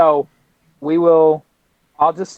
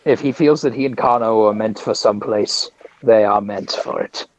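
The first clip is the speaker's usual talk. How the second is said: measured, lower-pitched voice of calm conviction; solemn Jedi; 6 semitones lower than usual. Acted. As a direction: intone it like a wise Jedi master. Plays a Jedi character.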